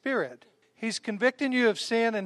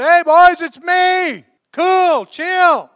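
high, loud, laid-back call; hammed-up casual Jesus; 9 semitones higher, 12 dB louder, much slower than usual. Jesus calls out to calm the disciples in the boat. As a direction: shout a breezy reassurance across the water.

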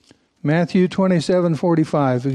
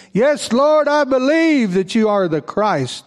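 fervent confession; 7 semitones higher, slower than usual. Acted. Reading Martha's confession of faith.

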